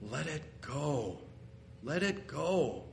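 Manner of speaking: very soft, slow; almost whispered, gently; soft hushed urging to let go; hushed plea. Acted.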